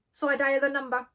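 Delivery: ordinary delivery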